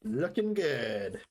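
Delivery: slow admiring drawl; slow drawn-out praise. Acted. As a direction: stretch it out slowly, admiring. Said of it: "drawn out approval".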